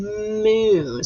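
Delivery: "moon" with a long humming M; stressed first sound. Shown as a demonstration. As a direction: hold the M that opens "moon". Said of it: "I hear the M sound".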